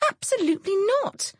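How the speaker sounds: vehement. A narrator's reading. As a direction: shut the idea down hard. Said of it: "I replied vehemently".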